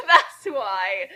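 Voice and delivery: laughing. Real, not acted. Laughing at fanfic.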